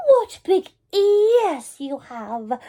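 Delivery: high, slow, amazed; girl staring at the wolf's ears, slow; slow wonder. A narrator's reading. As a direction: draw out each word in awe. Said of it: "she said slowly".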